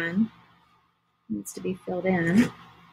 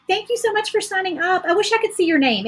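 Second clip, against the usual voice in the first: excited gratitude; 10 semitones higher, 4 dB louder than usual. Real, not acted. Greeting new sign-up on stream.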